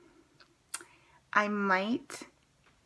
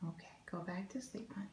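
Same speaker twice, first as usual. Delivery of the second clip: soft unvoiced whisper; 14 dB quieter, faster than usual. Real, not acted. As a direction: whisper so you don't wake them. Whispering to a sleeper.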